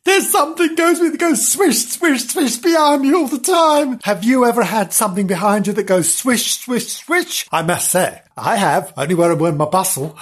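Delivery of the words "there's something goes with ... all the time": high, frantic storybook voice; squealing alarm; panicked yelp. Acted. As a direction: yelp the complaint in mock panic. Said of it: "yelped Frank".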